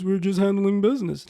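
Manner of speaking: mock standard accent, put-on; put-on non-New Orleans accent; code-switched proper voice. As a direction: play a non-Southern business accent. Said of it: "everybody ... has a New Orleans accent".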